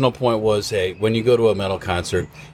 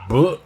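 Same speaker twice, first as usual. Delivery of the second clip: short higher mock vomit noise; mock retch; 8 semitones higher, 3 dB louder than usual. Showing how the drunk friend puked, mock retch. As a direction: spit out a fake retch. Imitating slow puking sound.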